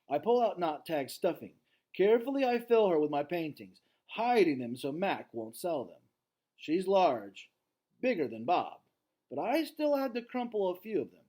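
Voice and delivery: story reading. A narrator's reading. Teacher reading the novel aloud to class, lively read-aloud. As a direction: read the chapter with energy to students.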